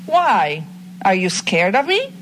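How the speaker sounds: normal talking voice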